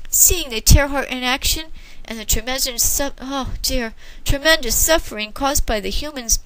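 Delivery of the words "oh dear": usual speaking voice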